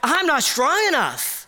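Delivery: pleading. Retold or imitated. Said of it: "was like".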